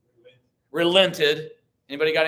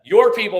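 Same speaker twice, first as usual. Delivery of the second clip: "your" punched high and loud; "your" leaps up, louder than the rest; stress on YOUR; 5 semitones higher, 10 dB louder than usual. Shown as a demonstration. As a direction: hit the word "your" hard. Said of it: "Notice that".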